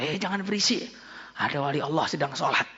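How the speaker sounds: softly telling others to hush; a quiet shush among worshippers; hushed warning. Retold or imitated. Congregation hushing each other for the saint, quietly. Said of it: "Sambil mengatakan".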